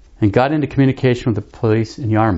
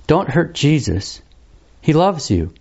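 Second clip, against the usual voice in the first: gentle, appealing; 4 semitones higher, slower than usual. Retold or imitated. Priest gently urging a young woman to go home, slow and tender. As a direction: coax her home gently, slowly. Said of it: "You said this so gently, so appealingly".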